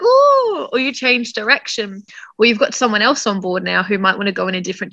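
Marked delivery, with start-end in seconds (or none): 0.0-0.7 high squeal of surprise; a sudden yelp as the boat keels; mock ooh. Sailor heeling over in strong wind, high surprised ooh. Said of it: "you're like".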